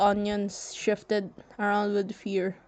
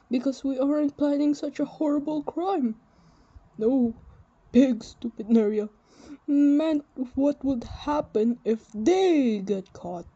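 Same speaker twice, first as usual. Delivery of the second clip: louder, higher, hurried backpedalling; nervous Antef; 5 semitones higher, 6 dB louder than usual. Acted. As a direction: cover for his friend, flustered.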